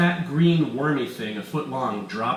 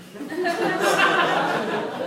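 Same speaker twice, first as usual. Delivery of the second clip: high maniacal villain cackle; evil cackle; 4 dB louder than usual. Acted. Weiss cackling over his scheme, high and maniacal. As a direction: cackle in triumph.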